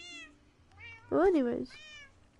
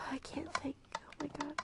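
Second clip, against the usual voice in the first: hushed whisper, I can't think; barely voiced frustrated mutter; whispered fluster; 11 dB quieter than usual. Real, not acted. Distracted streamer whispering to herself, flustered.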